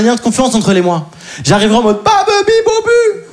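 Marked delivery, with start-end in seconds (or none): 2.0-3.3 high, slow outburst. Pretend. Imagined angry outburst at her.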